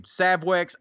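normal talking voice

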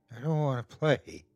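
frightened, understated refusal; quavering voice. A narrator's reading. Deb refuses Tony's deadly game, voice quavering. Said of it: "her voice quavering".

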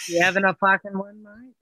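put-on Australian banter; Australian accent. Expat caller plays along with the hosts, mock Australian accent. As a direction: answer back in mock Australian. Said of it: "I thought you were gonna be Australian".

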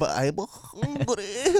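very slow, high plaintive voice; tiny newborn voice. Pretend. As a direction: drag it out like a starving newborn. Newborn speaking.

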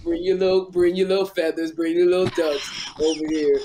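low, put-on cartoon caricature; Kim Whitley. Comedian calling the little ducks over, mocking impression. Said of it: "This is Kim Whitley talking".

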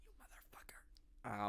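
a mock-menacing whisper; breathy whispered insult; whispered aside. Real, not acted. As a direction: whisper it, slow and hushed.

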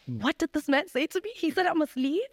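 high, shocked, outraged disbelief; indignant disbelief. Retold or imitated. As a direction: ask it like you can't believe your ears. Outraged retelling of the boyfriend's words.

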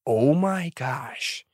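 slow, soft oh my gosh; drawn-out amazed. Retold or imitated. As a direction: stretch it out, amazed. Reacting to age gap.

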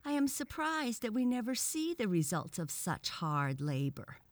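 high-pitched mock surprise; sarcastic. A narrator's reading. Frog questions the results of schoolwork, sarcastically. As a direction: feign amazement to belittle. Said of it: "said the frog with sarcasm".

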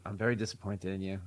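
low, soft, let down; disappointed mother. Retold or imitated. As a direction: say it quietly and heavily. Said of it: "All she had to do was say".

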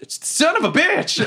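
high, drawn-out mock yell; stretched high-pitched curse, no real volume; acted yell. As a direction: cry out in pained frustration, hammed up. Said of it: "And I just yell".

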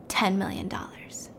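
secretive whisper. Retold or imitated. Doctor tells Akash about the big money, whispered. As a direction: let the figure out in a whisper.

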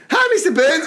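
high, eager schoolboy greeting; little kid imitation. Little kid with his bag greeting teacher, high mock-innocent voice. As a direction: pipe up as a small pupil.

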